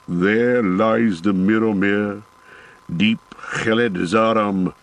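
slow, a touch of mournful awe from the dwarf; understated sorrow, lightly voiced dwarf; sad dwarf. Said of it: "said Gimli sadly".

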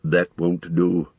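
slow, stern wizard's rebuke. A narrator's reading. Wizard questioning the hobbit, stern. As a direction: refuse the excuse firmly and slowly. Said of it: "said Gandalf sternly".

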